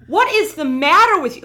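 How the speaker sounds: loud, high exasperated demand; exasperated shout. Retold or imitated. Girlfriend confronting boyfriend about midnight movie, loud exasperation. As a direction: let him have it.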